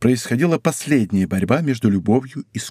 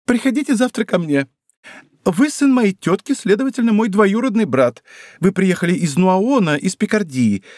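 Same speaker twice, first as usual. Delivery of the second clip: higher, brisk coaching woman's voice; scheming wife; 7 semitones higher than usual. Acted. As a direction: lay out the plan to him. Wife instructing Porthos. (деловитая жена прокурора, выше)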